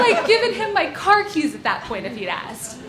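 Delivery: normal talking voice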